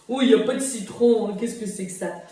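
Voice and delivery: mock southwest French accent, loud at the start; lightly put-on southwest French grumble; bad local French accent. Guest complaining about lemon bits, in a mock local French accent. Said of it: "really bad local accent".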